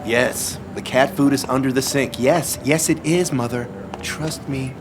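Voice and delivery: exasperated reassurance. Acted. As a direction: insist as if to a doubting mother on the phone. Arguing with Mother on the phone.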